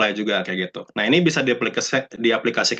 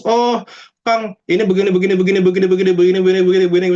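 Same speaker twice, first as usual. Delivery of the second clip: high, louder, relentless run-on babble; mock endless rambling; 8 semitones higher, 4 dB louder, faster than usual. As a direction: rattle on as if foaming at the mouth. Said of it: "sampai berbusa". (nyerocos tanpa henti sampai berbusa)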